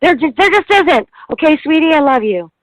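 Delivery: imitating mom. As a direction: play a frazzled mom brushing off help, then sweet. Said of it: "And she's like".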